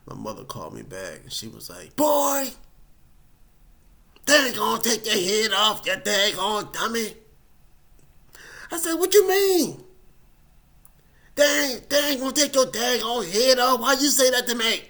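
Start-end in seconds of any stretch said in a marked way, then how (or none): 2.0-7.2 put-on loud, high scolding boy; exasperated mother telling him off; scolding mom. Mom scolding her son on the phone, loud exasperated. 9.0-14.8 high, loud hurt protest; wounded shouting back; indignant outburst. Retold or imitated. Son protesting to his mom, loud indignant.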